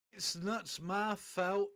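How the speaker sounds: usual speaking voice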